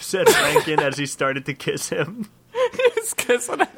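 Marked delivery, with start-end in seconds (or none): none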